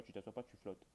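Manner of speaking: ordinary talk, nothing marked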